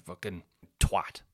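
ordinary talk, nothing marked

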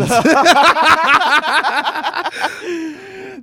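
high loud burst of laughter. Real, not acted. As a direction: let a big laugh explode high and loud. Loud laugh after joke.

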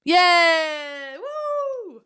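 real celebratory yay and woo; excited cheer. Real, not acted. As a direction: cheer like you just scored. Celebrating a correct quiz answer.

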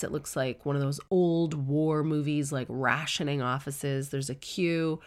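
ordinary talk, nothing marked

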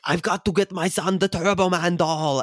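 Austrian accent, mock earnest; Schwarzenegger impression. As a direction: Arnold desperate for the doll, Austrian accent. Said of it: "he's like".

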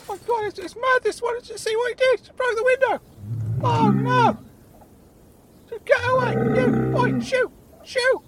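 very high, loud panicked squeal; Claude. Acted. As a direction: shoo it away in a frantic squeaky voice. Claude shoos the bull.

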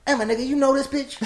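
high-pitched, incredulous street challenge; gangbanger voice. Retold or imitated. Gangbanger questions his companion about the woman, high and incredulous. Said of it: "the gangbanger to my right goes".

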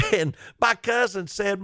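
normal talking voice

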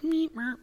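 high-pitched little "meep" beeps; imitated beeps. Patient imitating the hearing test tones, high beeps. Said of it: "like all these little beepies".

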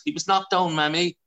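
high, lightly voiced little boy begging; a hint of a child asking mum to keep the bird; pleading child. Child pleading for injured pigeon.

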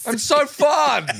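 slow, high and loud through laughter; laughing. Real, not acted. Boasting to host about being fun, laughing. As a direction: squeal it out while laughing. Laughing at own dinner party joke.

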